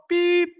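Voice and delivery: bleep out the swear word yourself; censor bleep. Pretend. Speaker censoring his own swear with a high bleep.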